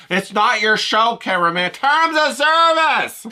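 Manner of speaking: high, loud, cocky taunt, with a slow, drawn-out "Terms of service"; brazen cameraman. Retold or imitated. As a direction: sass the host like a cheeky sidekick. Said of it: "acting all brazen".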